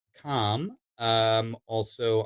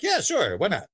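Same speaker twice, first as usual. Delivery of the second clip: breezy agreement; 7 semitones higher than usual. Retold or imitated. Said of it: "so I'm like".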